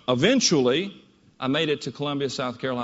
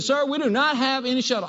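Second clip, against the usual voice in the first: higher, blunt Southern clerk voice; higher Southern woman, matter-of-fact; southern female clerk; 7 semitones higher than usual. Retold or imitated. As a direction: flatly tell him there's no shuttle, Southern style. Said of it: "very southern female voice".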